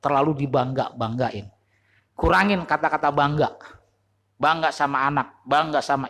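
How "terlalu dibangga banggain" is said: usual speaking voice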